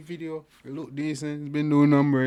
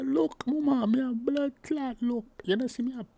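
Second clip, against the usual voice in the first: "me a blood clot look" in high, loud Jamaican patois; mock Jamaican accent; 13 semitones higher, 3 dB louder than usual. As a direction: belt the line high in the singer's Jamaican patois.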